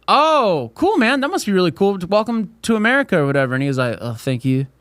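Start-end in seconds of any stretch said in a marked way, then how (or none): 0.1-3.2 high, upbeat retold welcome; enthusiastic welcome. Student welcoming a Canadian teammate to America, enthusiastic. 4.0-4.7 put-on French Canadian accent. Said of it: "there was Canadians on the baseball team".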